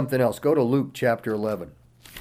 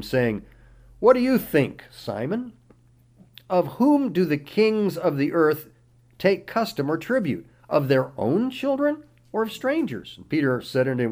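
raised, declaiming Bible reading voice; scripture reading; 5 semitones higher, 6 dB louder than usual. Acted. Pastor reading Matthew aloud to the congregation, declaiming. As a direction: read the verses out like a preacher in full flow.

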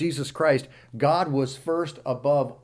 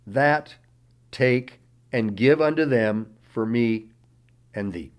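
raised, declaiming Bible reading voice; scripture reading; 4 semitones higher, 7 dB louder than usual. Acted. Pastor reading Matthew aloud to the congregation, declaiming. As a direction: read the verses out like a preacher in full flow.

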